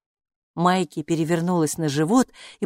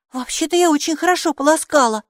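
indignant retort, much higher and louder; arguing; 10 semitones higher, 5 dB louder than usual. A narrator's reading. Girl argues with the robe she rinsed well, indignant. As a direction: defend yourself, offended. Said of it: "заспорила Майки".

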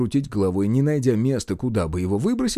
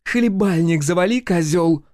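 high, hard woman's retort; raised-pitch quarrel voice; angry insult; 6 semitones higher, 4 dB louder than usual. A narrator's reading. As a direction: shut him up with venom.

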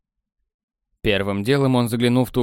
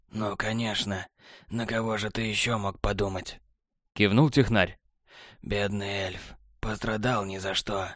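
lower, much softer, cool and smug; cool technician; 3 semitones lower, 7 dB quieter than usual. A narrator's reading. Technar answering Borland, low smug and soft.

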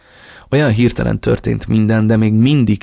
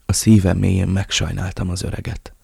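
ordinary delivery

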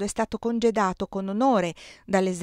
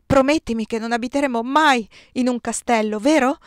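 higher, louder insistent request; urgent plea; 4 semitones higher, 6 dB louder than usual. A narrator's reading. Bride begs fiancé to avoid castles, urgent plea. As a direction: press for a promise with raised voice. Pleading after the outcry.

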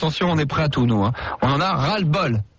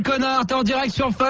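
higher, jeering yell; mocking shout; 7 semitones higher than usual. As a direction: gloat at the victim. Canular, il nargue l'employé. (se moque de la victime en criant)

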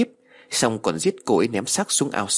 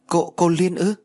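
high, louder startled question; stammering surprise; 9 semitones higher, 4 dB louder than usual. A narrator's reading. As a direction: gasp out the name in shock. Child stammers in disbelief.

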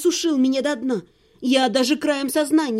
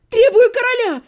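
high, loud hysterical yell; girl screams for the king amid the chaos; shouting demand; 9 semitones higher, 7 dB louder than usual. A narrator's reading. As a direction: demand the king at the top of her voice.

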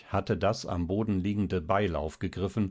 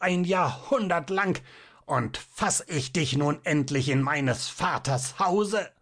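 high, crazed, almost demonic voice; raving, high-pitched demonic gloating; wild demonic scream; 9 semitones higher than usual. A narrator's reading. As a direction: screech it in triumphant madness. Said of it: "schrie er mit wilder, fast dämonisch klingender Stimme".

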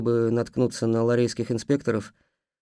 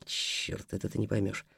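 muttered aside; 4 semitones lower, 10 dB quieter than usual. Acted. Aside under breath.

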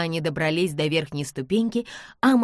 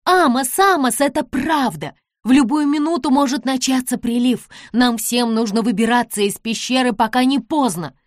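loud, high, urgent warning; desperate shout; 5 semitones higher, 7 dB louder than usual. A narrator's reading. Nancy warns the men from the stairs, shouting desperately. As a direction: call out desperately from a distance. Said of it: "отчаянно закричала с лестницы".

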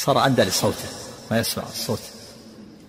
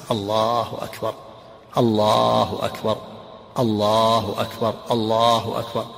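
each "الله أكبر" slow with a pause after it; the preferred measured adhan, one phrase at a time; slow, separated takbir; much slower than usual. Shown as a demonstration. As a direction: stop at the end of every "الله أكبر". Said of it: "كل جملة منفصلة عنه".

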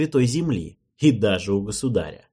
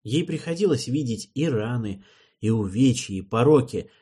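ordinary delivery

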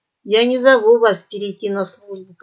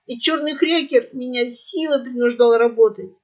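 pleading parrot; 4 semitones higher, 6 dB louder than usual. Acted. Parrot begs for life.

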